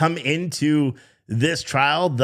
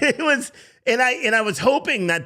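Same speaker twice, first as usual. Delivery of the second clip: ordinary talk, nothing marked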